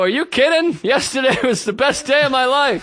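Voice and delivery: high, loud gushing reply; overeager enthusiasm. Retold or imitated. Bluffing to a woman about yesterday. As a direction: oversell it with fake excitement. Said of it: "And I go".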